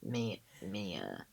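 lightly imitated nasal teacher, drawn out; halting attempt at a teacher's nasal voice; nasal English teacher. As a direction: mimic a student's impression of a nasal teacher. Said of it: "nasally, maybe you say".

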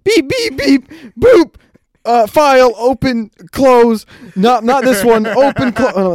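loud, high robotic beeping voice. Retold or imitated. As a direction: do a beeping robot searching files. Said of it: "you turn into a fucking robot".

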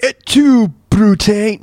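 mock the grand dying speech; overdone stage delivery; theatrical Caesar. Mocking Caesar's famous dramatic line.

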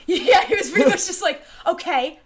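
can't stop laughing as she tells it. Real, not acted. Laughing while retelling call.

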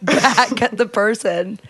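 ordinary delivery

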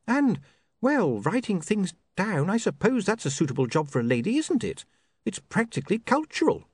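put-on high, sharp young woman's voice; an offended, prim young lady; indignant young woman. Sacharissa prim.

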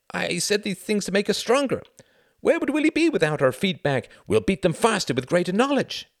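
high, pleading self-justification; anxious appeasing. A narrator's reading. Pilots undoing their offense to the leader, pleading. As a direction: plead your loyalty in a high voice.

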